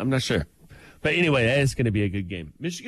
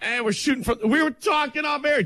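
yelling; 12 semitones higher than usual. Real, not acted. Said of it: "Don't, don't yell it".

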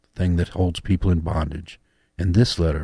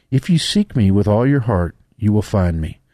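higher, louder preaching; preaching a love letter; 3 semitones higher, 6 dB louder than usual. Acted. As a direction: proclaim it to the congregation. Preacher reads God's letter loudly.

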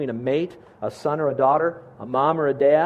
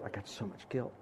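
guilt fading to almost nothing, much softer; retold sobbing; 6 semitones lower, 17 dB quieter than usual. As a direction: let the words die away softly. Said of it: "And she's sobbing, saying".

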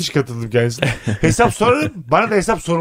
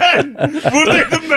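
high-pitched protest through laughter; laughing protest; 7 semitones higher than usual. Real, not acted. Panelist defending his position in a quarrel, protesting through laughter. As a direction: defend yourself while cracking up.

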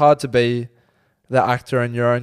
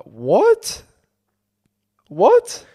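high baffled what; disbelieving, rising pitch; incredulous; 8 semitones higher, 4 dB louder than usual. Retold or imitated. Baffled reaction to complaint.

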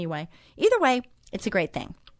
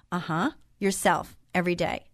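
ordinary talk, nothing marked

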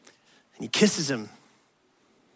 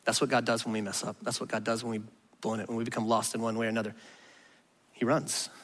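softer, lower, intimate preaching; tender sermon close; 8 semitones lower, 5 dB quieter than usual. Real, not acted. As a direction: speak close and gently to each listener.

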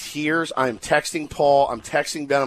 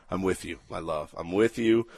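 tender reply; 9 semitones lower, 7 dB quieter than usual. Retold or imitated. Reply to wife.

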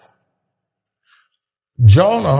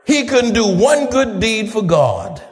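preaching at full force; 10 semitones higher than usual. Acted. Preacher shouting the thief's story to the congregation, full-force preaching. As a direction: lift the whole church with it.

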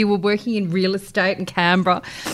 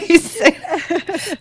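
laughing; 9 semitones higher, 5 dB louder, much slower than usual. Real, not acted. Echoing while laughing.

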